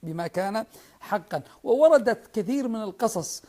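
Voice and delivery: rising passionate oratory; fiery preaching. Real, not acted. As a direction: preach it with full conviction. Impassioned storytelling in sermon.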